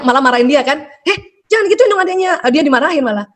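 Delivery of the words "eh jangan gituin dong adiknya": high, sharp mock scolding; a parent snapping at the child, pitched up. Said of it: "orang tua suka gini kan".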